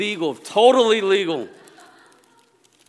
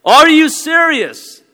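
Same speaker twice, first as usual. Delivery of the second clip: stretched, very high sing-song yell; inner scream; 8 dB louder, much slower than usual. Retold or imitated. Inner scream of disbelief at the candidate, high, slow and loud. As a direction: scream it in your head in disbelief. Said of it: "I'm screaming in my brain".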